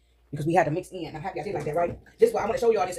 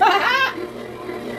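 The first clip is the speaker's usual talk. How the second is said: laughing; 13 semitones higher than usual. Real, not acted. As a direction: burst into a high laugh. Laughs at own story.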